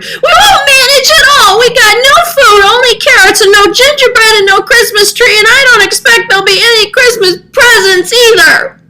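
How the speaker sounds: very high, loud, furious cat rant; a cat exploding at the old man; sputtering mad cat. Acted. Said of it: "hopping mad and sputtered".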